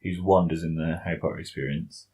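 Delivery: ordinary delivery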